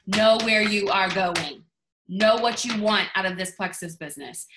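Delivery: ordinary talk, nothing marked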